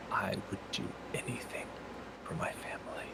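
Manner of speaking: hushed unvoiced whisper. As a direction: breathe the words without voice. Character answers in a whisper.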